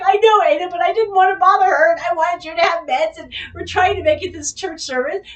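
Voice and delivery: pleading excuse. Retold or imitated. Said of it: "And I'm just like".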